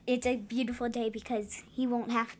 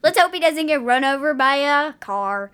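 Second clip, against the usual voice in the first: mock carefree parents; 4 semitones higher, 7 dB louder than usual. Mocking Dennis's parents.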